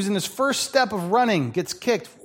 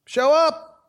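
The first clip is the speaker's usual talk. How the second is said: emphatic call; 9 semitones higher, 4 dB louder than usual. Acted. Preacher repeats key command.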